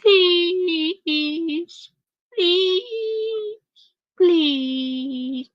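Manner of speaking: high, drawn-out mock begging; whiny nasal pleading. Host mocking a woman begging for money, whiny nasal pleading. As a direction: imitate her nasally whine, loud at first then stretched out. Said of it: "with that whiny ass nasally".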